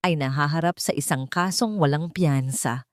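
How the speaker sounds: plain reading voice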